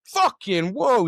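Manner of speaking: high, loud, drawn-out shock; startled. Retold or imitated. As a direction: mimic someone jumping back startled. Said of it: "literally jumped back".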